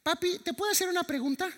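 voz mucho más aguda de niño que pregunta; voz de niño pequeño. Retold or imitated. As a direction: imita a un niño de tres años, mucho más agudo. Voz del hijo de tres años. (hijo de tres años le pregunta a papá, voz aguda)

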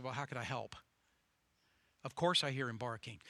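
lightly voiced weary politician; low weary politician. Politician answering the farmer, low and soft.

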